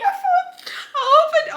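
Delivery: high, loud words broken by tearful laughs; laughing through real tears; sob-laughing, barely getting words out; crying laughter. Real, not acted. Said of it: "I've literally got tears in my eyes".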